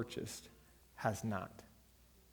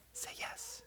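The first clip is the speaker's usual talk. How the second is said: whisper; 17 dB quieter than usual. Acted. Advising younger self, whispered.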